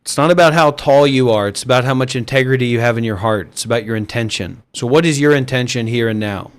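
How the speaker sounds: low, soft guru voice; subdued, low dharma-talk delivery; spiritual teacher counsel. Acted.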